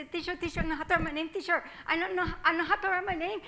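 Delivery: high, quick, eager pleading; eager child. Acted. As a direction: bounce with excitement to get the teacher's attention. Child begging the teacher for attention.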